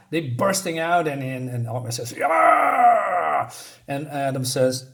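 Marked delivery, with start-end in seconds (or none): 2.1-3.5 high, loud, hammed-up war whoop; battle cry. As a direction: yell like charging into the fight. Hero bursting out in a fight.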